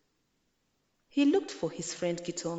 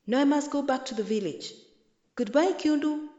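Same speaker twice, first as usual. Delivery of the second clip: hurried goodbye; 7 semitones higher, 5 dB louder than usual. A narrator's reading. Mwiti leaving in a hurry.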